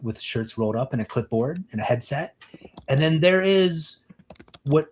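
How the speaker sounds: ordinary delivery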